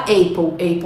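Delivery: "apple" wrongly said as AY-pol; "apple" starting like the letter A; mispronunciation demo. Shown as a demonstration. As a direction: say "apple" with the vowel of "ape". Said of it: "tem gente que fala apple".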